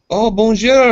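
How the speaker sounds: high, loud, hammed-up French greeting; showing-off French. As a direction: play a would-be charmer flaunting French. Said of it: "chicks dig that shit".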